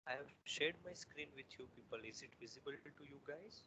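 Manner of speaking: very faint, far from the mic; speaking far too softly; barely audible. Real, not acted. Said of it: "but your voice is a bit low".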